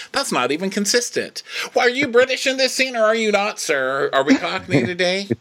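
high, hammed-up mock British accent; terrible fake British accent. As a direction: challenge him in a bad, squeaky British accent. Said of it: "so many terrible fake accents".

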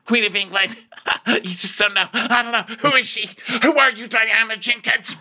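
very high put-on babble; Rinna. Rinna puzzling over who Diana is, high caricature.

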